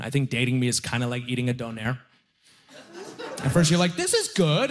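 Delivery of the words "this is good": pleased inner voice. Retold or imitated. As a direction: chirp it happily like a first delicious bite. Inner voice eating donair.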